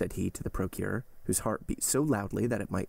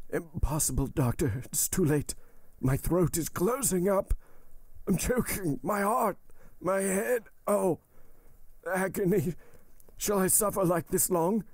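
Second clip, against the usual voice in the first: high, strained cries of agony; agonized choking; 10 semitones higher than usual. A narrator's reading. Poisoned servant choking before the doctor, acted high-pitched agony. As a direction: plead as your throat closes up. Dying Berrois choking in a fit.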